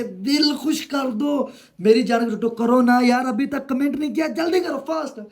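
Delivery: high-pitched affectionate nagging for comments; playful pleading. Real, not acted. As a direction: coax them in a rising, high voice. Playfully begging viewers to comment.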